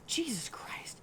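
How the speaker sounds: hushed, unvoiced gasp of dread; real scared whisper under the breath; frightened whisper. Real, not acted. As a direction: whisper it in alarm. Scared mutter in horror game.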